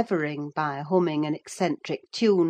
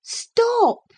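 very high, loud cry of protest; sharp cry; 6 dB louder than usual. A narrator's reading. Catherine stops her cousin smoking, crying out high. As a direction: cry out to halt him. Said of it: "she cried".

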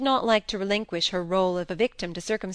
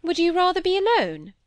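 higher-pitched, mildly hurt question; a little wounded; slightly aggrieved; 10 semitones higher, 4 dB louder than usual. A narrator's reading. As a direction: ask it with a touch of offence. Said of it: "slightly aggrieved".

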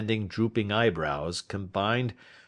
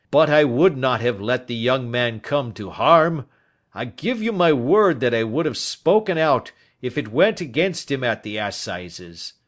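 loud, higher-pitched earnest protest; anguished old Turner; 5 semitones higher, 9 dB louder than usual. Acted. Guilty old man swearing he would have spoken, anguished. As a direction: insist on it forcefully, easing at the end.

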